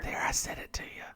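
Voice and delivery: hushed, voiceless aside; real whisper. Real, not acted. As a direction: whisper it off to the side, away from the listeners. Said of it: "Should I whisper to them still?".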